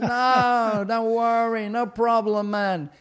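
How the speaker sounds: loud, high crowd reply; shouted reassurance. Retold or imitated. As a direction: holler it back like a busload of people. Passengers shout back their reply.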